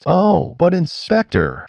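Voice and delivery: a touch of sourness toward the inspector; sourly. A narrator's reading. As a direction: needle him sweetly but sourly. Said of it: "rather sourly".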